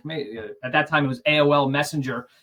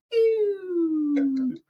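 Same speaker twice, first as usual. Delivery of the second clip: high laugh. Real, not acted. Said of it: "So it's like".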